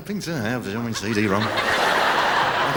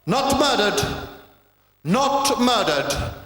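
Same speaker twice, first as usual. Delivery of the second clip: much higher, slow, shouted; hammed-up wrathful shout at a poster; bitter outburst; 11 semitones higher, much slower than usual. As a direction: proclaim it like a vengeful preacher. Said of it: "my only thought was to go".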